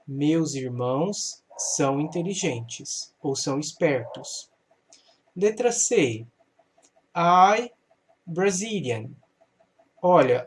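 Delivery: usual speaking voice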